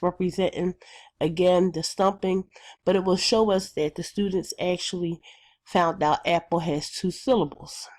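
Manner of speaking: ordinary talk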